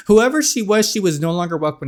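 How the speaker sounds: talking through a high laugh. Acted. Cerise dismissing her former self, laughing. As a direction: laugh it off, half-mocking.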